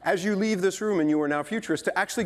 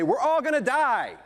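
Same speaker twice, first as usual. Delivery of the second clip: mock doom announcement; 7 semitones higher than usual. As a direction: blurt it out like a party killer. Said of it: "a party and say".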